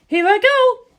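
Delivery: high, bright-voiced young woman, confident; high female character voice, calm and sure; Lisa Lisa's voice. A narrator's reading. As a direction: lift into her high voice and reassure.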